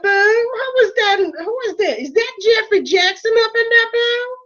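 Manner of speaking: high, fast, sassy drag diva; sassy drag queen. Acted. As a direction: camp scolding queen, honey boo. Drag persona scolding toilet cleaner.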